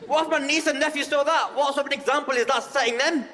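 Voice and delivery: high, loud, hammed-up outraged bigot; mock outrage. Mocking an outraged diner in McDonald's, shrill loud outrage. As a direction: shriek the mock indignation.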